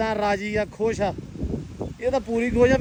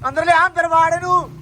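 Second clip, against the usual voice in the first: alarmed shout; 8 semitones higher, 3 dB louder than usual. Real, not acted. Scooter left out in rain.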